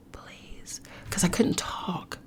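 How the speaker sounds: barely there unvoiced whisper; retold pained whisper. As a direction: breathe it out in pain. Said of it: "All I could do is whisper".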